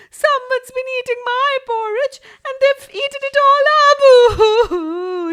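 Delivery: put-on very high, loud, crying baby bear; high squeaky crying Baby Bear; tiny little voice. A narrator's reading. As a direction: wail it like a tiny upset cub. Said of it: "he cried in a tiny little voice".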